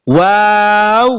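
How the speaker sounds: ওয়াও stretched slowly with a rise; drawn-out Arabic waw. Shown as a demonstration. Teacher models the hard letter, long rising ওয়াও. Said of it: "এই হরফটার উচ্চারণ হবে".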